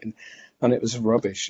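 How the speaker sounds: ordinary delivery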